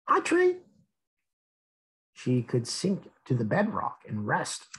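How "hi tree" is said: much higher tiny voice; sweet greeting. Acted. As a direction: say hello like a small friendly child. Drop greets tree.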